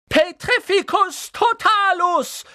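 very high, loud, drawn-out incantation; shouted spell. A narrator's reading. Hermine casting a spell on Neville, shrieking it. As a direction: scream the spell out slowly. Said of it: "schrie sie".

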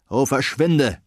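put-on gruff, impatient old wizard brushing him off; grumpy wizard. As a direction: irritably wave away a pest. Wizard snaps at Rover. (ungeduldiger Zauberer)

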